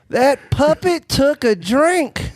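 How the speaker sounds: high, drawn-out mock astonishment; mock amazement. Spectator marvels at a walking puppet, mock amazed.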